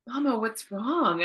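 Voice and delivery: put-on slow, high-pitched kid voice; young child imitation. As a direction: imitate a small child asking, slowly. Voicing her young son.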